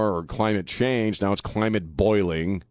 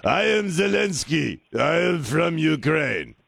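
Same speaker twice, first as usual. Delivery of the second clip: slow, higher mock Eastern Bloc accent; Zelensky, guttural Eastern Bloc accent; 5 semitones higher, slower than usual. Radio host parodying Zelensky for a caller, guttural Eastern Bloc accent. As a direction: put on a heavy, drawn-out guttural Ukrainian accent. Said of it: "That sort of standard Eastern Bloc guttural".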